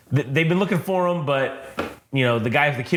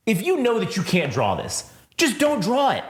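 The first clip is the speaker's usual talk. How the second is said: exasperated rant; 9 semitones higher than usual. Real, not acted. Host ranting at the artist.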